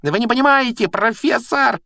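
very high, frantic squeal. A narrator's reading. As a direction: shriek your protest far above your normal pitch. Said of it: "визжал Малфой". (отчаянно визжать тонким голосом)

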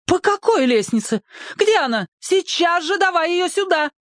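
high, scolding, panicked; mother ordering him down; frantic mother. A narrator's reading. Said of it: "бушевала внизу мама". (высокий встревоженный окрик)

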